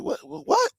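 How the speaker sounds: high-pitched mock bafflement; baffled reaction. Acts out confusion at southern accent.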